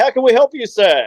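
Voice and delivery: high, overly polite "how can we help you, sir"; playing innocent clerks; mock polite shop assistant. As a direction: put on a cheeky service voice. Mock service greeting to customer.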